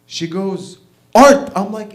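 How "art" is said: one loud, high mock shout; exasperated shout. Wife answers what was stolen, exasperated mock shout.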